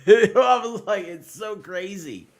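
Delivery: high laughing disbelief, slow; giggling at how crazy it was. Real, not acted. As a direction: laugh through the words.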